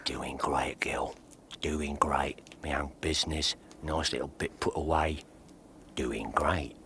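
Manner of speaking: soft, low whisper; a low boastful whisper; hushed whisper. Acted. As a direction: breathe it close and secret. Disguised Holmes whispering to the maid.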